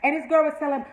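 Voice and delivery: normal talking voice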